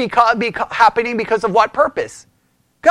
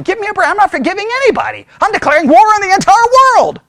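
defiant refusal; 8 semitones higher, faster than usual. Pretend. Voicing worldly unforgiving attitude.

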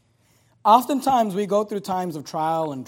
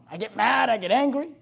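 ordinary delivery

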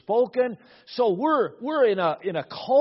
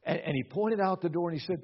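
usual speaking voice

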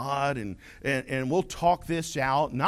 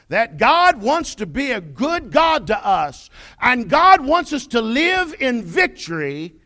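loud, high pulpit proclamation; full-force preaching; 7 semitones higher, 9 dB louder than usual. Real, not acted. Preacher tells congregation what God wants, full-force preaching. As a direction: proclaim it to the whole church, punching each phrase.

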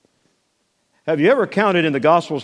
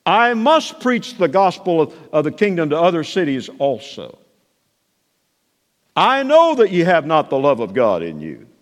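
authoritative quoting; 3 semitones higher than usual. Acted. Preacher voicing Jesus' sayings.

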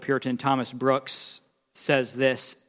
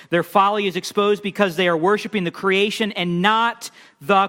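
raised, driving pulpit voice; preaching at full force; 6 semitones higher, 4 dB louder than usual. Acted. Preacher warning the congregation about idolatry, full force. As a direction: hammer the point home to the congregation. Preaching hard on Israel's folly.

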